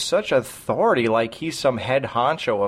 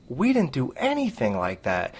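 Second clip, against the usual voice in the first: ordinary talk, nothing marked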